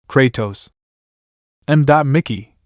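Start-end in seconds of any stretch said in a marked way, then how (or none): none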